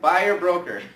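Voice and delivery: emphatic repeat. Shown as a demonstration. Coach drilling the class, loud and emphatic. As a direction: say it so the room repeats it. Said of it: "I want everybody to say that".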